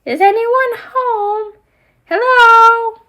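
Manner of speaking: high, loud doll voice calling into the house. Acted. Ariel doll calling again for anyone home, high and loud. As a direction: call out like no one is answering.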